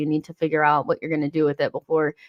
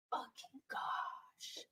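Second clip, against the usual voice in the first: slow, breathless whispered swear; whispered curse; 23 dB quieter, much slower than usual. Real, not acted. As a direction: drop to a slow whisper of disbelief. Exasperated aside.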